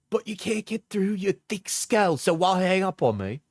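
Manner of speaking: mocking high voice. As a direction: sneer it out high and whiny. Mocking partner's messages again.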